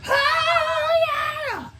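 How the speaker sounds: very high shouted oh yeah; drunk whoop. Retold or imitated. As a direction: let out a wild concert yell. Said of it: "I'm over there drunk going".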